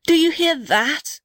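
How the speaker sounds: high, slow hushed urgency; urgent. Acted. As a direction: ask urgently, alert. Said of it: "cried the big blue gum".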